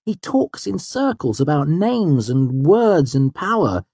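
high, emphatic venting; exasperated complaint with swooping stresses. A narrator's reading. Heated complaint about his mentor.

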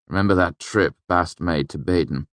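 plain reading voice

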